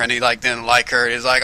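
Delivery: ordinary talk, nothing marked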